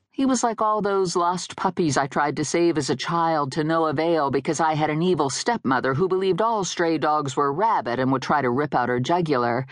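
plain reading voice

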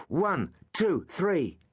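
high, counting beats aloud; called out. Acted. Suetonius counts blows again for his friends, called out. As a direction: call the count like a drill. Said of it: "as Suetonius called out".

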